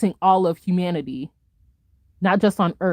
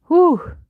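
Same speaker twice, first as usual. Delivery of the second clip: loud exhale; 10 semitones higher, 5 dB louder than usual. Real, not acted. After a long rant.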